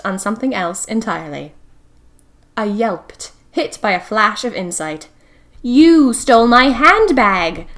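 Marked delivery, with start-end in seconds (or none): 5.6-7.7 loud accusing outburst; sudden loud accusation, slowed and punched; crying out. A narrator's reading. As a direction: shout the accusation in shock. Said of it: "I cried".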